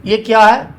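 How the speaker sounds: ordinary talk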